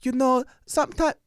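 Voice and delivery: put-on high, loud Japanese accent. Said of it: "my Japanese accent came off kind of Italian".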